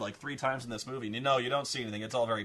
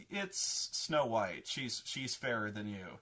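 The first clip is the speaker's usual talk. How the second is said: slow mirror verdict; 2 semitones lower, 4 dB quieter, slower than usual. Retold or imitated. Mirror delivers news slowly.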